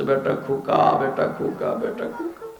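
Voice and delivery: fading from soft to a whisper; mother pleading, crying. A narrator's reading. Mother in a dream pleading with her son, fading to a whisper. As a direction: plead softly until the voice dies away. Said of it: "কাঁদতেছে আর বলতেছে".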